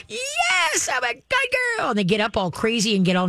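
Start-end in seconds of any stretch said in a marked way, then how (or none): 0.1-1.9 very high, giddy dog voice; excited dog. Retold or imitated. Trainer voices the dog's reply, very high giddy squeal. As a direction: squeal like a dog thrilled to be praised. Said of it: "What does the dog do?".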